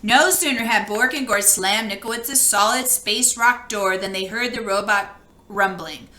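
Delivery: plain reading voice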